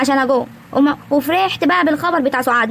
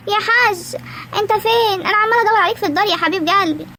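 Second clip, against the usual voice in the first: high-pitched call through the house; calling out sweetly; 6 semitones higher than usual. Acted. New bride searching the house for her husband, calling out high. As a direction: call for your husband in a raised, lilting pitch.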